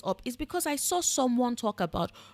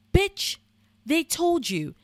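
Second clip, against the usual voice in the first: exasperated; 4 semitones higher, slower than usual. Real, not acted. Exasperated rant at roommate.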